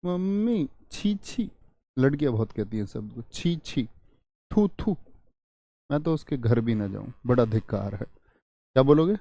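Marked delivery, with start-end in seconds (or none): 0.0-1.5 put-on high, soft girlish disgust. Shown as a demonstration. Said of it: "घृणा पैदा हो". 3.2-8.0 disgust. Shown as a demonstration. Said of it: "घृणा".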